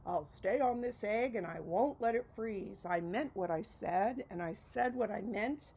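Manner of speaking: loud, steadfast declaration; firm vow. Acted. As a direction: proclaim it proudly and loud, slowing at the end. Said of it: "said with a sneeze".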